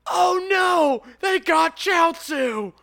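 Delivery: very high, loud anguished shout; hammed-up horrified cry, stretched out; Trunks in mock horror. Mistaking the android's head for a friend, horrified shout. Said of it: "He's like".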